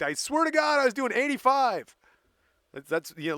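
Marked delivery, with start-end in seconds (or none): 0.0-2.0 a higher, louder mock protest of innocence; hamming up a driver's plea to the cop; mock Earl protest. Earl swears to the cop he was only doing eighty-five, in a higher, louder voice. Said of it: "but he's like".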